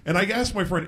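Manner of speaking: ordinary delivery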